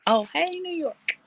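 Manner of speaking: high, bright "hey, New York" to the chat; cheery greeting. Acted. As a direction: wave hello to a viewer. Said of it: "Phoenix says".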